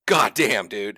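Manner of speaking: loud exasperated outburst, easing off. Retold or imitated. Player fed up with an interrupting buddy, exasperated. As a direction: retell being fed up, hit the first words hard.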